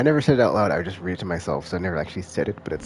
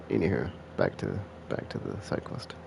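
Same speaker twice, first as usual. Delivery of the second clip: usual speaking voice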